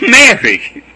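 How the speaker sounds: loud, high laughing call of her name; joyful laughing greeting. Acted. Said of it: "laughed Jesus".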